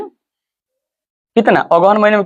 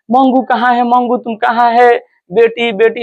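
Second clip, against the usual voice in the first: imitated crying out; 6 semitones higher than usual. Mother searches for her missing daughter, imitated cry. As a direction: wail the question high, without really shouting. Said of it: "ऐसे चिल्लाने लगे".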